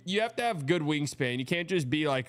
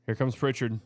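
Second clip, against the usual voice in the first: ordinary talk, nothing marked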